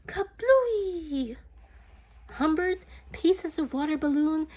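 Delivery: loud, high kablooey; excited burst. A narrator's reading. Balloon bursts over Beatrice, loud high kablooey. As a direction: make the pop explode.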